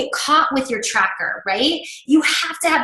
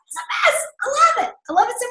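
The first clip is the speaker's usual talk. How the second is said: squealing, much higher it's the best; gushing delight; 14 semitones higher, much faster than usual. Retold or imitated. Coach recalling her own delight to the group, high gushing. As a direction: gush over it like a thrilled fan. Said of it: "And I was like".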